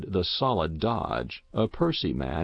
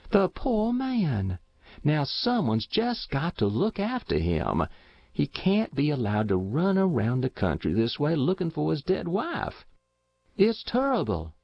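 pitying farm wife; 6 semitones higher than usual. A narrator's reading. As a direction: fret over the poor man. Matilda alone in kitchen pitying.

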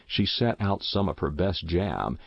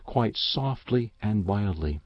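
plain reading voice

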